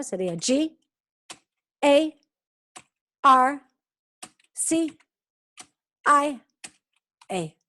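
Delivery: teacher spelling an example surname; spelling out letters. Shown as a demonstration. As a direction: spell each letter out brightly. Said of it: "si fuera Santoni García".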